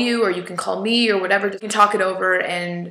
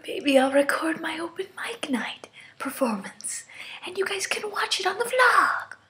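hushed, a little higher; whispering; 9 semitones higher, 3 dB quieter than usual. Real, not acted. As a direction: keep it to a whisper. They're all sleeping.

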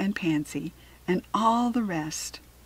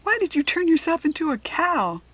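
high, louder astonished question; baffled girl, pitch and volume raised; exclaiming girl; 9 semitones higher, 5 dB louder than usual. A narrator's reading. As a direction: cry it out in disbelief. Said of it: "cried Harriet".

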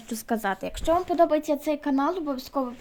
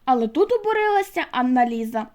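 usual speaking voice

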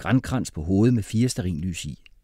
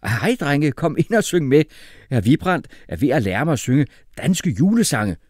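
high, loud cheerful invitation; shouting; 6 semitones higher, 5 dB louder than usual. A narrator's reading. Father waving a songbook at the boys, calling out. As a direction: call them in to join the singing. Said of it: "råbte far".

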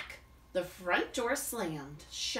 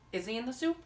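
ordinary delivery

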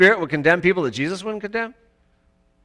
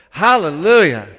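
call of hallelujah; 7 dB louder than usual. Real, not acted. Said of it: "Let's just say it together".

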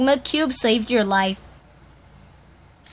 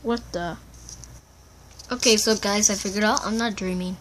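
usual speaking voice

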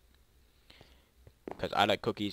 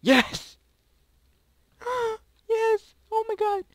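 very high, loud squeals of triumph; ecstatic scream; 11 dB louder, slower than usual. Real, not acted. Player celebrating a narrow escape, ecstatic scream. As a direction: scream it out, overjoyed and gasping.

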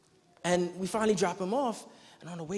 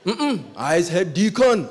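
put-on slow, louder Jamaican accent, refusing; 2 semitones higher, 11 dB louder, slower than usual. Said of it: "rough voice Jamaican guy".

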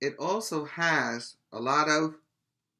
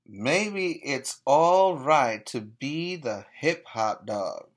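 usual speaking voice

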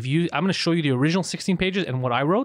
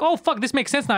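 surprised; 9 semitones higher than usual. Retold or imitated. Girlfriend suddenly gets the script.

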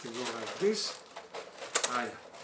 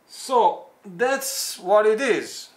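realizing; 7 semitones higher, 11 dB louder, slower than usual. Real, not acted. Dawning realization right after surprise.

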